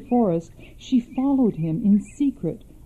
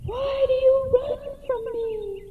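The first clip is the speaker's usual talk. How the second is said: very high, soft, floating echo; 5 dB quieter than usual. Acted. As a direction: repeat it as a far-off echo. Echo repeats his call.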